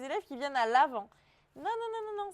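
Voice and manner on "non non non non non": quick, higher run of no's, teasing correction; light scolding no, amused; playful no. Real, not acted. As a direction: wag a finger at the class with your voice. Correcting students' common mistake.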